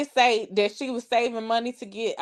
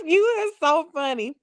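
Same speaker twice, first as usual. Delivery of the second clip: ordinary talk, nothing marked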